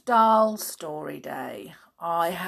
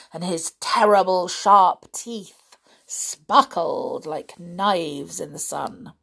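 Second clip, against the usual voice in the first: ordinary delivery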